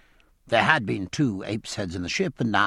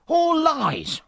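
a much higher, furious interruption; angry interruption; 3 dB louder than usual. Acted. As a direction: cut in with outrage. Rogers interrupts in passion.

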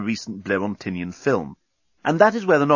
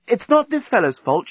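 crying out; 12 semitones higher, 3 dB louder than usual. A narrator's reading. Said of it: "she cried out".

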